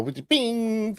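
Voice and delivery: high cartoon ping sound; high mock ding. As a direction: squeak it like a shooting gallery bell. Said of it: "the guy go".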